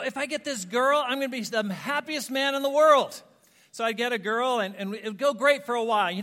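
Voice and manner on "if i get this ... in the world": eager hope. Retold or imitated. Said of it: "I'd go".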